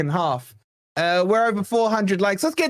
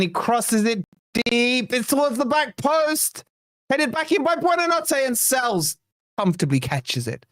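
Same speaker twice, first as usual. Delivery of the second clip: higher, rising play-by-play as the cross comes in; excited commentary; 6 semitones higher than usual. Real, not acted. Streamer calls the corner to viewers, excited and higher pitched. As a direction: call it like the ball might go in.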